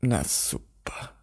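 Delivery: slow, soft disgusted sarcasm, imitated. Bathrobe owner to the culprit, imitated slow disgust. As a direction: drag it out in mock disgust. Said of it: "sie hat so angewidert".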